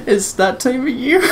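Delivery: words squeezed out through helpless laughter; laughing hard. Real, not acted. Laughing at co-host joke.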